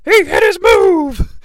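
mock announcer calling the finishing move; Tony Schiavone call. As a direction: belt it like a breathless play-by-play call. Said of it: "I don't say that to mock Tony Schiavone".

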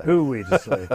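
repeats the line through a laugh; laughing echo. Real, not acted. Echoing his co-host's quip, laughing as he repeats it. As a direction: laugh the words back at him.

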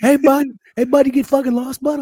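put-on high, loud heckling hockey kid; Canadian chirp. Hockey kid telling a stranger to get lost, high loud heckle. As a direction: chirp like a mouthy puck kid. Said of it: "chirping hockey kids".

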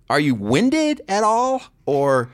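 usual speaking voice

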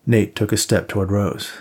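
ordinary delivery, nothing marked

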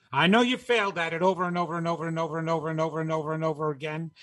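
racing, chanting repetition of the failures; fast, relentless self-reproach; rapid-fire self-scolding. Acted. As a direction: rattle off the list without a breath. Scolding himself, piling up failures.

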